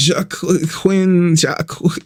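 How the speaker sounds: Joaquin broken up as "Juh-k... kwin, Jah-k..."; stuttering. Retold or imitated. Vlogger unsure how the fighter's name goes, stuttering on Joaquin. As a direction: stumble over Joaquin, breaking off mid-name. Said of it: "I'm, like, stuttering".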